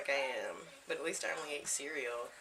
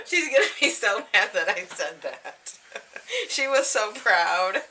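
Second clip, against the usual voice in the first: laughing; 5 semitones higher, 12 dB louder than usual. Real, not acted. Laughing over friend's story.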